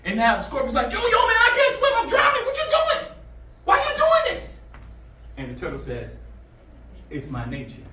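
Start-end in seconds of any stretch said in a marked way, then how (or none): 0.9-4.6 very high, squealing scorpion; loud falsetto panic; mock drowning panic. Drowning scorpion pleads with turtle. 7.1-7.8 low, soft turtle reply; quiet, lowered punchline voice; understated payback. Acted. Turtle coolly repeats scorpion's line.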